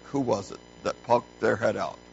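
ordinary talk

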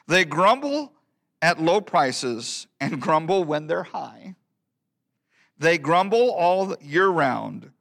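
high, swinging sing-song verse; emphatic poem recitation. Acted. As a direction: punch each grumble in a rolling rhythm. Recites a humorous old hymn poem.

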